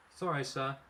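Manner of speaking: ordinary delivery, nothing marked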